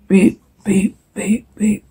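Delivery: quiet, odd robot beeps; robot beeping. Acted. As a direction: beep like a little machine.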